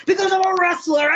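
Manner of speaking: high, loud comic shout; excited blurt. Retold or imitated. New wrestler telling strangers at last, shouted proudly. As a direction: burst out with it, mock-bragging with a laugh. Blurting out the long-awaited answer.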